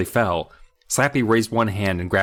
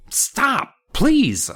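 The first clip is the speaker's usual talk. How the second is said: high pleading; 3 dB louder than usual. Acted. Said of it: "I pleaded".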